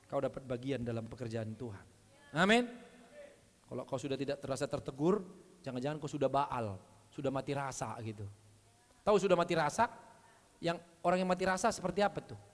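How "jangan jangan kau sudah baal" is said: ordinary talk, nothing marked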